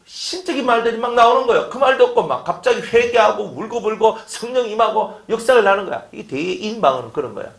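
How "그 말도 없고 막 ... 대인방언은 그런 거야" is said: normal talking voice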